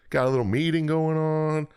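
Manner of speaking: high, breezy trickster coaxing; mock fairy lord. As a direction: play the smooth-talking fairy lord, way up high. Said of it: "Fairy lord's like".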